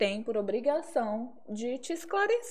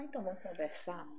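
very soft, low, held-back invitation to talk; controlled calm; 6 semitones lower, 14 dB quieter than usual. Acted. Keeping her cool before a hard talk, restrained calm. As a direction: lower your voice to keep your temper in. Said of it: "tenho que respirar e falar".

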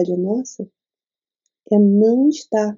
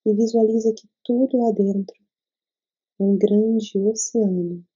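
guided meditation. Acted. Leads a meditation.

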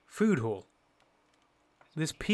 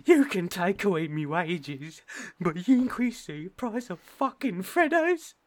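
higher, slower, louder hammed-up rant; mock outraged citizen; 6 semitones higher, 4 dB louder than usual. A mock citizen berates Mister Johnson, hammed-up outrage. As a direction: rail at the prime minister like a wronged citizen.

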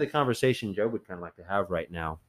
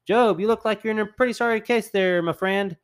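higher, louder, breezy mock God; God's voice; 9 semitones higher, 4 dB louder than usual. God cheerfully telling suffering Job he'll be fine, hammed-up and higher. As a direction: play God as a chummy fixer. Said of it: "be like".

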